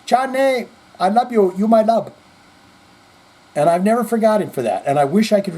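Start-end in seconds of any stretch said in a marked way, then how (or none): 0.1-2.1 put-on higher, fond Spanish-accented I love you; imitating a Spanish accent. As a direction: fondly imitate an old coworker with a Spanish accent. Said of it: "But he would say".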